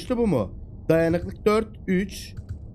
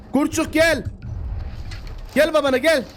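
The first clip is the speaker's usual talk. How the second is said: coaxing call to a pup; 9 semitones higher than usual. Real, not acted. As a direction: call the cub to daddy. Calling the wolf cub.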